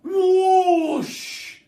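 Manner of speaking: very high, very loud shriek; shrieked whoosh. Acted. The chicken shooting into the air, piercing loud shriek. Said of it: "shrieked the chicken".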